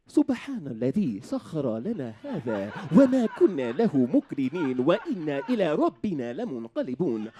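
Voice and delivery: fast, polished announcer-style prayer. Retold or imitated. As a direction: recite the travel dua like a smooth pilot.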